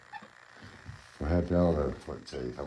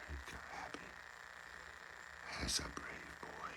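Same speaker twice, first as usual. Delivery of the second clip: whispered praise; 5 semitones lower, 20 dB quieter than usual. Real, not acted. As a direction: hush it out to the pet. Praising monkey softly as he comes.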